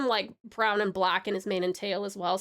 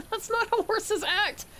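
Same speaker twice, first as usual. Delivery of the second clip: higher, drawn-out, laughing protest; mock-indignant correction; 9 semitones higher, slower than usual. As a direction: burst out with a playful correction. Blurts correction after timid opening.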